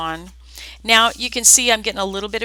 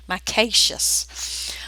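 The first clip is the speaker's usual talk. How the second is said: slow, drawn-out southern accent; playful southern accent, leisurely pace; much slower than usual. Shown as a demonstration. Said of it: "say that with a southern accent".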